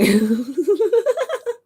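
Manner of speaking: high squealing laugh; high laughing fit at a silly misreading; high giggle. Real, not acted.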